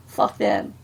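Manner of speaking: dropped low and offhand; dismissive fuck them. Retold or imitated. Teacher demonstrating her motto, low and dismissive. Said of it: "ways in which they can use those two words".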